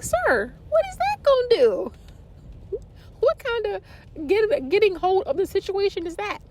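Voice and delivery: high, incredulous mock outrage; mock indignant. Demanding of the silent doctor what his peeking will do, mock outraged. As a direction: scold the doctor in disbelief. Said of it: "I was like".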